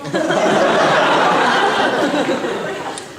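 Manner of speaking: big high burst of laughter; loud laugh. Real, not acted. Big laugh mid-story.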